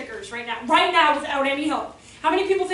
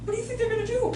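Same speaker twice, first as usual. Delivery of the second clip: ordinary talk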